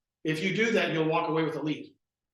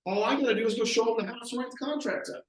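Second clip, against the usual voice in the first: higher, breezy self-assured tone; confident explaining; 6 semitones higher than usual. Retold or imitated. Young agent boasting he knows their budget, breezy. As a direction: make it sound easy and obvious. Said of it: "He's like".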